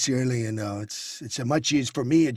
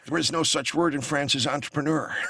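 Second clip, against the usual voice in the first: George W. Bush impression; 2 semitones lower, 4 dB quieter than usual. Talk show guest slipping into a George W. Bush voice. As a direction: do Bush fumbling a line about France. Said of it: "became George W".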